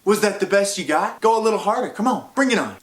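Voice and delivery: high, cocky taunt. Retold or imitated. Taunting friend to throw harder.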